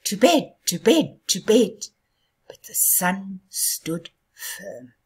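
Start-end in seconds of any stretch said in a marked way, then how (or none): 0.0-1.9 loud, high furious shriek; angry shriek. Acted. Furious wind shrieking at the sun, loud and high. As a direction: shriek the order in a rage. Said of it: "He shrieked in an angry voice".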